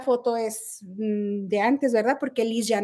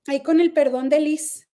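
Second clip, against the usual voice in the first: ordinary delivery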